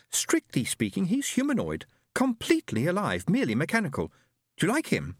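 proud sister voice; sister's voice. Acted. Sister presenting robot.

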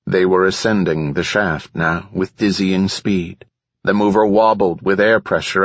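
plain reading voice

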